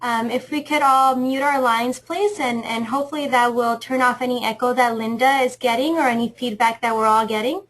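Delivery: normal talking voice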